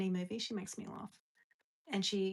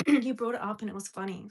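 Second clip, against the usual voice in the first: "You brought it up" said out loud and louder, after clearing the throat; louder retort; 8 dB louder than usual. Real, not acted. Repeating her comeback to the viewer out loud, louder. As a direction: say it full voice now, owning it.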